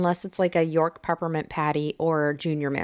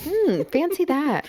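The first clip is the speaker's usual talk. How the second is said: mock surprise; 9 semitones higher, slower than usual. Teasing reply.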